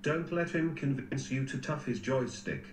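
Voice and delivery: ordinary talk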